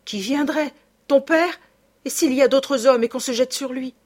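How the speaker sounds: tense. A narrator's reading. Raymonde answers her frightened sister.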